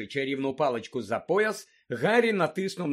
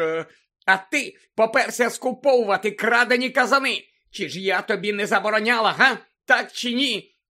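high, loud interrogation; angry scolding; 5 semitones higher, 6 dB louder than usual. A narrator's reading. Old woman grilling her guilty lookout, angry. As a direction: demand an answer furiously.

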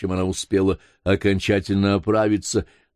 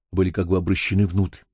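ordinary delivery

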